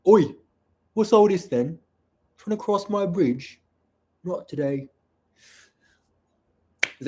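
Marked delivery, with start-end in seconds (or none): 0.1-4.9 high-pitched bad Cockney giant; bad Cockney accent. Shown as a demonstration. Two-headed giant guarding the bridge from trespassers, bad Cockney accent. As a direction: challenge intruders in a hammed-up Cockney accent. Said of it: "a bad Cockney accent for a two-headed giant".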